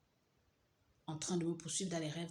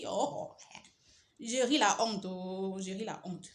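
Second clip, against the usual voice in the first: laughing loudly; 2 semitones higher, 6 dB louder, slower than usual. Real, not acted. Laughing at herself.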